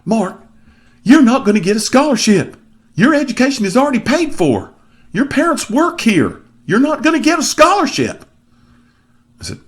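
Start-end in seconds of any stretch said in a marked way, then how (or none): 0.1-8.2 high, loud imitation of a Southern man; Southern accent. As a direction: scold him in a hammed-up Southern voice, pitched way up. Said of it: "southern accent, he said".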